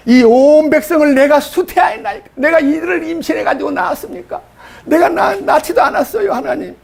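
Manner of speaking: high, half-laughing disbelief; incredulous protest. Retold or imitated. Moses to God about birthing the people, high incredulous laugh. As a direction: scoff up high at the absurd demand.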